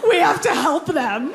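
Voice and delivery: high, gushing pity for the nurses; mock gushing. Mocking people who cheer nurses, high gushing voice. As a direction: gush like a sappy fan of the nurses.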